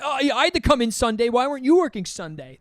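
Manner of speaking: high-pitched mock indignant whine; mad complaining. Deli coworker complaining about Sunday shifts, mock mad. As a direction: imitate an aggrieved coworker, voice pushed up high. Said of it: "they get mad at you".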